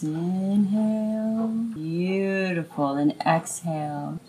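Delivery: guided meditation. Acted. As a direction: ease them into slow breaths. Leads breathing exercise.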